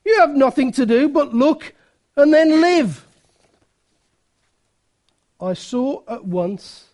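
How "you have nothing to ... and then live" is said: raised, high preacher's cry; acted-out fervent shouted exhortation; Primitive Methodist shout. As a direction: shout it from the pulpit in short, punched bursts. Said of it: "he shouted, as only a primitive Methodist will do".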